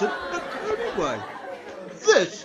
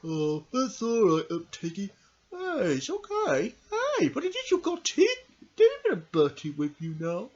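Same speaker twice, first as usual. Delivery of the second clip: soft, soothing reassurance; gentle comforting; 6 dB quieter than usual. Acted. Consoling Tiggy after sad news, gentle and soft. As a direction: ease off and console softly.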